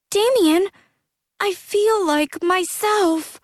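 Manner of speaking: high, light joyful youth; young man full of joy. Acted. Jack voiced, joyful.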